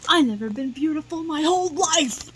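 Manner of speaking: loud, wailing complaint; loud protest. Retold or imitated. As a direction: cry out that you never were pretty. Acted quarrel with Sophie.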